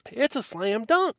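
high-pitched mock boast, rising at the end; mocking quote. Repeating the rival researcher's claim about the fingerprint match, high mocking crow. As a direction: parrot a rival's cocky claim. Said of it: "as Walt Brown said".

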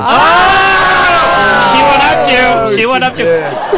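high, loud whoop easing off; mock outrage shout. Host heckling after a groaner pun, hammed-up shout. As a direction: cry out high, then ease down.

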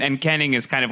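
usual speaking voice

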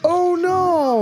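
long, high cry of dismay; dismayed wail. Real, not acted. Watching horse get fatally kicked.

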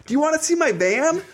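high sing-song offer; high voice, unaware it sounds creepy. Retold or imitated. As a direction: make it sound like an awkward creep. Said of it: "I'm like".

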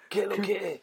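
playful imitation Mexican accent, laughing. Friend echoing the actor's greeting, mock Mexican accent. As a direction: put on a light Mexican accent. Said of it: "in Mexican".

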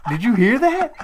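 high, loud mock-urgent question; alarmed questioning. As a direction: imitate a rattled man, half laughing. Electrician asks again, acted out.

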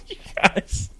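breathy laugh through the words. Real, not acted. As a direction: gasp it out while laughing.

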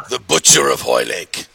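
low, theatrical tabloid villain title; hammed-up ominous delivery; mock-dramatic nickname. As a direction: announce it like a menacing headline. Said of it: "have to say it that way".

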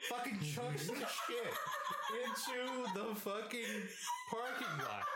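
high, slow, laughing through it. Real, not acted. Telling the throwing part, cracking up. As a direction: tell it cracking up.

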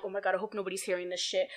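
low, soft, hurried aside; hushed, rushed retold thought; imitated worried aside. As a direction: say it fast under your breath, as if overheard. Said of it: "I'm still looking around like".

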